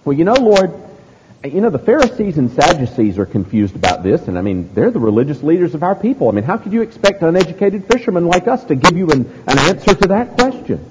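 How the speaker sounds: hammed-up wishy-washy fisherman; mock hedging disciple. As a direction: squirm through a non-answer, pitch climbing at the end. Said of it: "Peter doesn't say".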